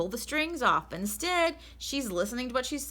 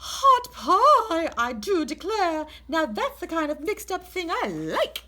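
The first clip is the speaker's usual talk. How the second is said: much louder and higher, relishing; old aunt thrilled by the pie; delighted Great Aunt Myra; 10 semitones higher, 10 dB louder, slower than usual. Acted.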